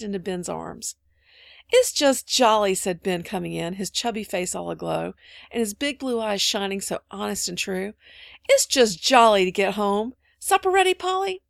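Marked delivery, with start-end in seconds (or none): 1.7-2.7 loud, slow, hearty greeting; jolly. A narrator's reading. Ben coming in the door, hearty and jolly. Ben arrives home glowing. 8.5-11.4 loud, hearty, lifting to a high question; jolly. A narrator's reading. Ben home and asking for supper, jolly.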